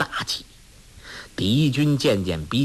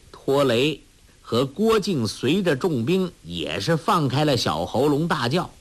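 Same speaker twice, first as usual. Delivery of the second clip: plain reading voice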